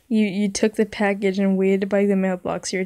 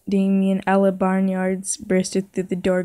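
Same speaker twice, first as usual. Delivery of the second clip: ordinary delivery, nothing marked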